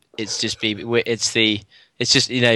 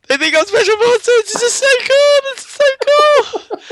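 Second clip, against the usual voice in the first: very high, loud excited squeal; giddy inner squeal; 5 dB louder than usual. Acted. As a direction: squeal it like a thrilled kid, drawing out the end. His giddy inner thought, acted.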